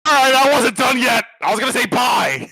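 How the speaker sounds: high, fast indignant protest; protesting shout. Real, not acted. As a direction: protest loudly and quickly. Protesting being cut off.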